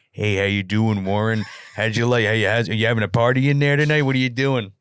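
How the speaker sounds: lightly voiced menacing phone caller; taunting caller. Acting out Bronson's taunting payphone call.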